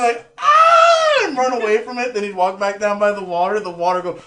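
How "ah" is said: very high, loud mock shriek; imitated frightened scream. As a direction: shriek as if fleeing a wave. Imitating fright at the incoming water.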